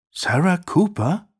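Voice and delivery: high, slow puzzled query; uncomprehending question. A narrator's reading. Aunt asking about a name she doesn't know, baffled. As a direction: repeat the unknown name in bafflement. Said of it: "fragte seine Tante verständnislos".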